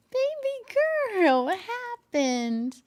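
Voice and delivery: slow, high, tender pet voice asking the cat what happened; pet talk. Real, not acted. Talking to her cat.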